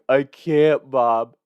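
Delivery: imitating a sobbing little brother; mock sobbing. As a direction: whine it like a crying kid faking nothing.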